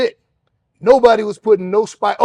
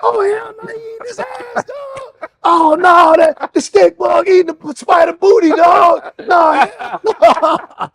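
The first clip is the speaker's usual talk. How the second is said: much higher, loud shouting with laughter at the end; freaked-out yelling; 12 semitones higher, 7 dB louder, slower than usual. Retold or imitated. Inmates freaking out as the mantis eats the spider, high loud yelling. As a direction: lose it watching the spider get eaten. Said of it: "They were like".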